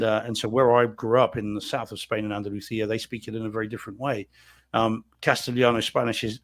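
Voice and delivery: ordinary talk, nothing marked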